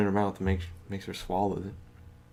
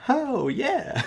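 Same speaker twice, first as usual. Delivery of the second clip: very high chirpy "Oh, yeah"; high mock-sweet reply; mock cheerful. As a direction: squeak it out like a delighted girl. Retelling smiling senior's reply.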